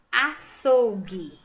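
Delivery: "Açougue" slow and loud, ending in hard gi; "Açougue" enunciated loudly by syllables; careful sounding-out. Shown as a demonstration. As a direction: stretch "Açougue", U after G silent. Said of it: "acho que não".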